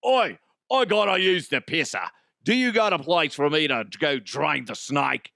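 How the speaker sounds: botched Cockney-ish voice, barely Australian; bad British accent. [name] asking for a toilet, in a bad British accent. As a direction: attempt a rough London bloke. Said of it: "that's not British".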